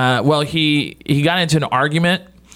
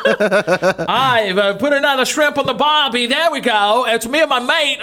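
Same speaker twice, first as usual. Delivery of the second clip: put-on higher, laughing stab at an Australian accent; mock Australian bloke, overdone; hammed-up Australian accent; 9 semitones higher than usual. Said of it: "please try again".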